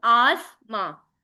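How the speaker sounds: "आस माँ" split into two separate beats; syllable-by-syllable. Shown as a demonstration. Teacher showing students what syllables are, "आस माँ" in two beats. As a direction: break "आस माँ" clearly after "आस". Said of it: "ये कितनी आवाजें हैं".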